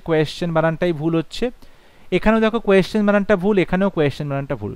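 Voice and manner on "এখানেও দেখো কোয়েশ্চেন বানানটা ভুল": ordinary talk, nothing marked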